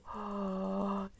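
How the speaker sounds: sharp gasp of delight; excited gasp. Real, not acted. Collector spots a Lego piece, excited gasp. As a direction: gasp at a lucky find.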